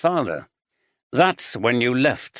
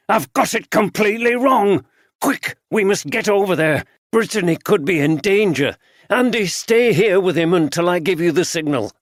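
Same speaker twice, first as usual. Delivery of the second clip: much higher, louder urgent orders; urgent alarm; 7 semitones higher, 4 dB louder than usual. A narrator's reading. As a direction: bark out orders in a rush. Inspector rushing to save Brittany.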